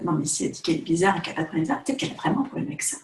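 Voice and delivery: fast, suspicious inner voice; rushed viewer's thought. Retold or imitated. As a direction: rattle it off like a sceptical viewer. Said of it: "tu te dis peut-être".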